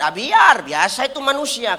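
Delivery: ordinary delivery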